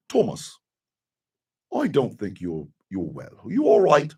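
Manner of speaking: quiet, low attempt at a friend's British accent; hushed, imperfect British-accent imitation; Elijah's accent. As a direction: softly check on a mate in a rough British accent. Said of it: "never be able to do your accent".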